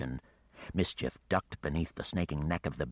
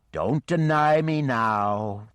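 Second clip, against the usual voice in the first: slow, loud, higher looming beast voice; menacing dragon; 6 semitones higher, 7 dB louder, much slower than usual. Acted. The dragon looms over Carlotti's head, slow and threatening. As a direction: lean in and drag each word out like a monster.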